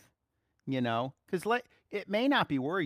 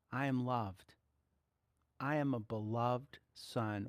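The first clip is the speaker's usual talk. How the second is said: gentle affirmation; 4 semitones lower, 4 dB quieter, slower than usual. Shown as a demonstration. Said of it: "go into it and say".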